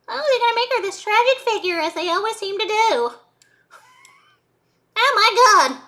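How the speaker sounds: high, loud put-on voice. Vlogger ranting about biopics, high put-on voice. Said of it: "I didn't know I could do that voice".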